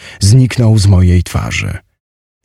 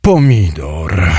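weary giant; 2 semitones lower, slower than usual. A narrator's reading. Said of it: "Olbrzym westchnął głęboko".

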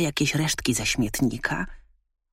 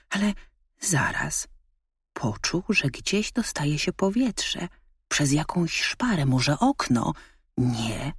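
usual speaking voice

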